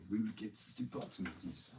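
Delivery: throaty put-on foreign accent; throaty, accidental action-hero accent; Arnold Schwarzenegger. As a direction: say it from the throat in a mock Germanic accent. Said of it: "I actually sounded more like Arnold Schwarzenegger then".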